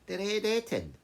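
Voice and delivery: high, loud startled echo; startled question. Acted. Brother startled as the shepherd names the Red Etin, high and loud. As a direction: blurt the name back in shock.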